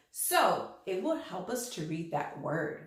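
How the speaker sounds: usual speaking voice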